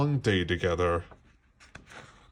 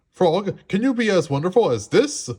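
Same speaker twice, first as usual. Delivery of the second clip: showing off; 8 semitones higher, 5 dB louder than usual. A narrator's reading. Toad showing off.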